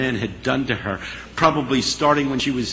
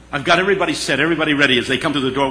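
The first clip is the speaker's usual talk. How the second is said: ordinary delivery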